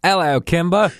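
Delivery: high, hammed-up British greeting; mock British accent. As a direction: imitate a teen idol's British accent, badly. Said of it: "[name] has gotten his British accent back".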